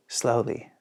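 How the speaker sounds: ordinary delivery, nothing marked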